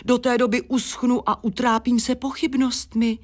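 high-pitched wail of impatience; dismayed squeal. A narrator's reading. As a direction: keep it high and fretful. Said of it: "vypískla Gladiola".

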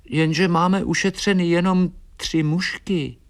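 small, high, downcast voice; saddened. A narrator's reading. Swallow realises their savings are only three flies, high and saddened. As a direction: confess it in a high, crestfallen little voice. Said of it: "zarazila a posmutnila".

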